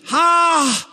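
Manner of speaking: high shrieked "Ha!" of a panicked demon; sudden high cry of terror; demon's shriek "Ha!". Acted. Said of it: "and screams".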